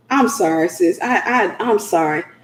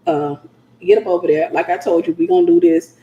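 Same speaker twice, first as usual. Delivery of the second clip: firm husband; 8 semitones lower, 3 dB quieter than usual. Retold or imitated. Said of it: "He was like".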